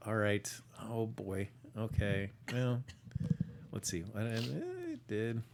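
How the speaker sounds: usual speaking voice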